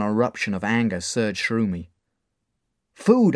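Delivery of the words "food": sudden high loud cry; blurted out. Acted. Starving boy blurting his answer: loud outburst. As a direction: burst out with it. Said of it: "I blurted out".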